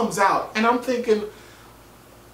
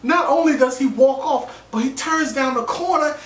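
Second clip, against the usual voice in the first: rising, louder, amazed; excited; 10 semitones higher, 3 dB louder than usual. Real, not acted. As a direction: build up the disbelief. Building amused disbelief.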